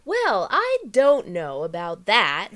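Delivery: slow, loud stand-up intonation; John Mulaney. Retold or imitated. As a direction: stretch out each word like a comedian mid-bit. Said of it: "the intonation. He's like".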